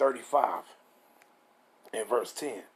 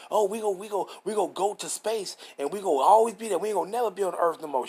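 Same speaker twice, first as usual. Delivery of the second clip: higher, excitable mock believer; derisive, pitched-up mimicry; mocking imitation; 5 semitones higher than usual. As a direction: parody their claim in a raised, hurried voice. Mocks those expecting life in space.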